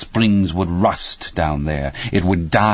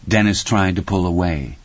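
plain reading voice

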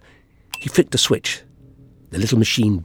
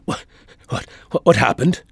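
weak, faltering, near-voiceless croak; 2 semitones higher, 3 dB quieter than usual. A narrator's reading. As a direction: croak it out on coming round. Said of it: "and croaked".

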